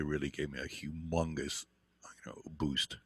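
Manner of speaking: whispered, touched and sincere; hushed. Real, not acted. Telling how a mentor's praise felt, in a hushed whisper. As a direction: breathe it out almost unvoiced.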